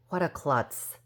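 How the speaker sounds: usual speaking voice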